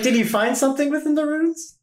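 high, lilting, eager question; curious merchant. Acted. Merchant asking visitors about the runes, high and curious. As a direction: pitch her question way up. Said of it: "she's like".

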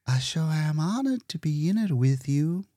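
mild, starry-eyed honor; Leora's voice. Acted. Leora to Hitz.

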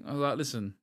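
ordinary delivery

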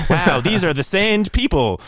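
exaggerated awe to ridicule the name; mock amazement. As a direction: play the clueless, marvelling outsider. Mocking outsiders naming a native race.

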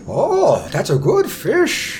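high, slow, savouring exclamation; delighted fish-lover. Acted. Someone admires a fish thrown in the parade, high delight.

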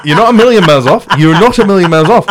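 put-on high, loud Welsh accent through a laugh. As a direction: keep the hammed-up Welsh accent going, laughing. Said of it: "do the Welsh voice sometimes".

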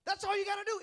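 ordinary delivery

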